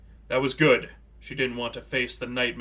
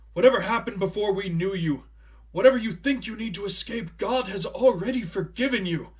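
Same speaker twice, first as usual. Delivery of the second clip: high, loud, frantic begging; desperate pleading shouts; 7 semitones higher, 5 dB louder than usual. A narrator's reading. Priest begging Cassandra not to use her talents, desperate shouts. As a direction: cry out to stop her, desperate. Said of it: "the more uneasy he grew".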